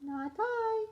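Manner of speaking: high squeaky kitty voice for the cat. A narrator's reading. Cat refuses to help the hen, in high mewing voice. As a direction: pitch it way up like a little cat refusing. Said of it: "the cat said".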